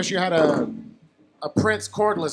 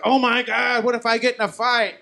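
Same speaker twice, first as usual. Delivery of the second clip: higher-pitched mock worry; mock alarm; 6 semitones higher than usual. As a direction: retell a sudden worried thought. Retelling own sudden worry.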